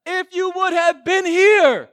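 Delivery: loud, high cry of grief; Mary crying out to Jesus over her dead brother; anguished cry. Retold or imitated. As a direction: call out Jesus's name in anguish. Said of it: "And Mary says".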